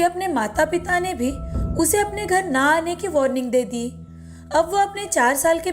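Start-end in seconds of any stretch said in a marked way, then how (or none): none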